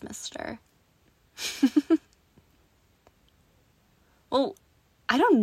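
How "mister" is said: breathy teasing whisper. Acted. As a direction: whisper it close to the ear. Seductive roleplay whisper.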